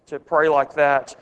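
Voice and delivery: normal talking voice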